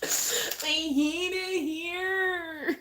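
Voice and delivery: high, very slow, whiny fake crying; mock wail. Pretending to cry to viewers about work, hammed-up wail. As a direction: drag it out like a hammed-up sob.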